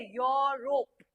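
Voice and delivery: slow "यूरोप" as "yaw... rop"; "यूरोप" drawn out with an open o first; correct pronunciation demo. Shown as a demonstration. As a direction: stretch "यूरोप" syllable by syllable, starting "yo". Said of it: "य वाला sound है".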